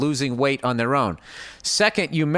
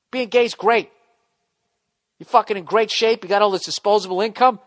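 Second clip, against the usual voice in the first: usual speaking voice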